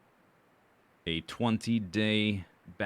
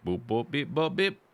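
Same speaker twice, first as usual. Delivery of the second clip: boops and beeps climbing in pitch; bouncy mouth-made beeps; playful beeping; 6 semitones higher, 4 dB louder than usual. Pretend. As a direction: chirp little rising robot noises. Playful beeping while sorting items.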